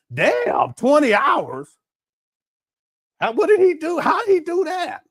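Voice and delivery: amazed. Retold or imitated. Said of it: "You be like".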